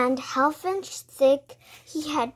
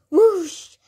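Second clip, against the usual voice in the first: louder, higher, drawn-out whoosh; imitated whoosh; 6 semitones higher, 7 dB louder than usual. A narrator's reading. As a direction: sweep it out like rushing air. Narrator imitates rush of air.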